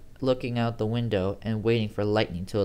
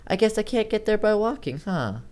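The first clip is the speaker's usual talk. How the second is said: Amy's voice; 11 semitones higher, 4 dB louder than usual. Acted. Narrator voices Amy on phone.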